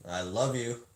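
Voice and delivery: ordinary talk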